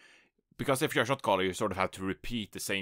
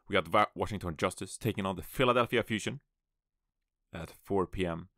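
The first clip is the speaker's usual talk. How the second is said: ordinary delivery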